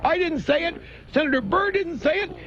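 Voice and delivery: high, whiny mock plea; mock pleading senator. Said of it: "out shouting from the rooftop".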